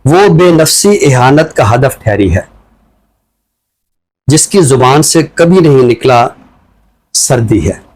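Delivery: slow, measured reading of a free verse poem; poem recitation. Acted. Host asked for a poem, he recites it slowly. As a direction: let each line land with a pause.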